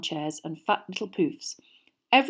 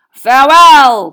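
loud, slow, higher farewell cry; stagey fake archaic declaiming; 6 semitones higher, 21 dB louder, slower than usual. A narrator's reading. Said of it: "cried the knight".

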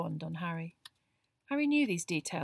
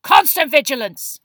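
sudden high, slow roar; 7 semitones higher, 19 dB louder than usual. A narrator's reading. Moody startles the whole class, roaring. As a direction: bellow it to make the class jump. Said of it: "he roared".

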